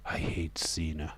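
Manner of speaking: soft secret whisper; whispered aside. Real, not acted. Streamer confiding to chat in a whisper. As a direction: hiss it under your breath.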